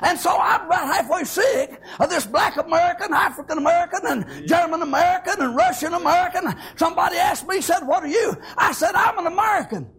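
loud, high preacher's cry with a laugh in it; preaching at full force. Acted. Preacher proclaiming to the church, loud and high. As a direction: call it out to the congregation.

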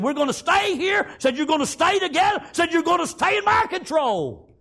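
high, loud domineering commands; tyrant's shout. Retold or imitated. King of Jericho commanding the trapped people, loud and high. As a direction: bark orders like a tyrant king.

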